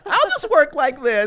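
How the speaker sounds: very high, slow, whiny joke voice; high-pitched screeching whine. Pretend. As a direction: drag it out in a silly squeal. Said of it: "I'm gonna talk like this".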